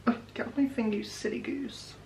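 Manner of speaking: soft scolding. Real, not acted. Talks to screen protector.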